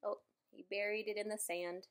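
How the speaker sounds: ordinary delivery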